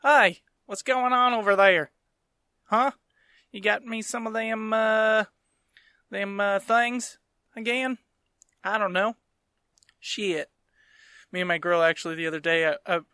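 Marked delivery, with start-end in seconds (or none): 0.0-10.5 put-on drawn-out, higher-pitched Southern accent. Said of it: "in an accent like that".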